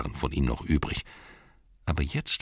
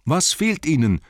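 high, raised question; insistent repeat; 11 semitones higher, 5 dB louder than usual. A narrator's reading. Bilbo repeats his question to Gollum, raised insistent voice. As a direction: press the question louder and higher.

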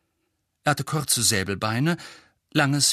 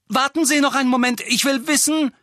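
fast, high, raised voice; hurried, loud call; urgent plea; 14 semitones higher, 5 dB louder than usual. Acted. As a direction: blurt it out to stop someone leaving. Harry urgently stops departing Figg.

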